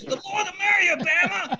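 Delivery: very high-pitched holler back; high, carrying mock shout; distant yell. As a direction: imitate a faraway director calling out. Director yells the answer again.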